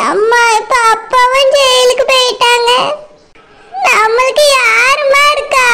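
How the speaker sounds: a daughter's tearful voice, put on; grieving daughter. Daughter asks her mother who they have left, tearful.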